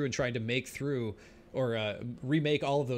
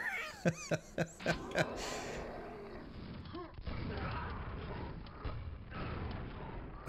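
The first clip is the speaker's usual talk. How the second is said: long breathless wheezing laugh; 9 semitones higher, 9 dB quieter than usual. Real, not acted. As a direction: laugh helplessly at the joke. Laughs at chat comment.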